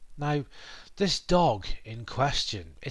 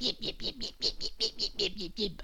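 high, soft little yips; yappy dog yips; 10 semitones higher, 6 dB quieter, faster than usual. Retold or imitated. Vlogger mimicking a neighbour's small dog, high yips. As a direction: mimic a tiny yapping dog.

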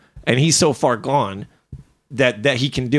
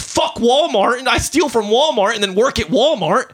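raised, high mock outrage; yelling; 8 semitones higher than usual. Real, not acted. Ranting about the Walmart example, yelling. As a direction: keep yelling through the example. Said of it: "I'm, I'm yelling".

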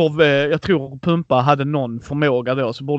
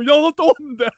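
high-pitched mock yell; retold scream; 9 semitones higher than usual. Driver yelling at the moped girl, amused mock scream. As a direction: repeat the scolding in a high imitated shout. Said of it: "Och sen skriker".

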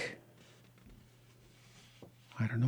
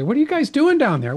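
high, loud calling down the stairs; wife's voice; 13 semitones higher, 5 dB louder than usual. Retold or imitated. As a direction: call down like the wife. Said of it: "my wife's gonna say".

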